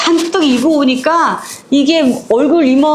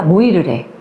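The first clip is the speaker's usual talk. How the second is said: ordinary talk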